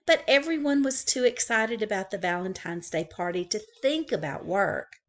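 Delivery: ordinary delivery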